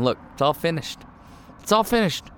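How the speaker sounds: acted announcement of giving up; fed-up Jesus. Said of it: "is this what Jesus is saying here?".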